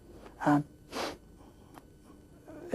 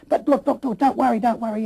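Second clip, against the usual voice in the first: higher, louder retold reassurance; insistent reassurance; 5 semitones higher, 8 dB louder than usual. Doctor reassuring a patient after bad news, insistent. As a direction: imitate a doctor rushing to reassure, pitched up and louder.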